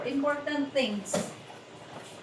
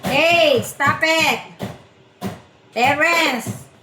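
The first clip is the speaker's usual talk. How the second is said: loud, raised-pitch telling-off; scolding; 5 semitones higher, 9 dB louder, slower than usual. Real, not acted. Mother telling crying Terrence to stop, scolding loudly.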